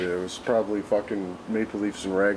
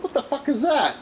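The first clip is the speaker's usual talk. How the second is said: surprised question. Retold or imitated. Retold reply to friend's offer.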